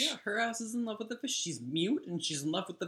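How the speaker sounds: high, animated backing-up; excited agreement. Real, not acted. Friend backing up the fish description, high and animated.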